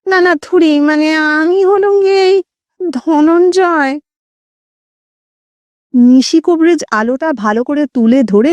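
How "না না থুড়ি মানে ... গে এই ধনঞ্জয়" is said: high nasal ghostly voice. A narrator's reading. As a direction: play a timid ghost speaking through the nose. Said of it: "খোনা স্বরে".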